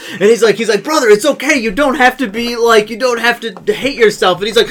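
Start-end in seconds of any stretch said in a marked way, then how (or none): 0.9-4.4 high, loud, hammed-up pleading; pleading consoler. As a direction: plead with an upset brother. Crab person consoling the screamer.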